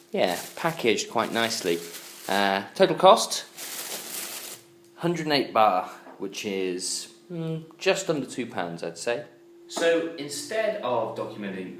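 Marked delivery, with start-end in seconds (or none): none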